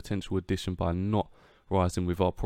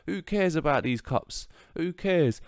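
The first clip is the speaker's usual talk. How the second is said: scoffing; 7 semitones higher than usual. Real, not acted. Dismissing the cup competitions.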